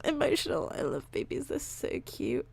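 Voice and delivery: soft, breathy, tearful whisper about babies; emotional whisper. Real, not acted. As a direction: hush it as if choked up. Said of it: "bloody cry about babies".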